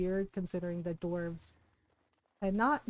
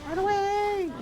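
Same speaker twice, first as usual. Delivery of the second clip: high, louder pretend-panicked cry; mock panic; 10 semitones higher, 5 dB louder than usual. Player fleeing so many enemies in game, mock panic. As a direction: call the retreat in a high, drawn-out wail.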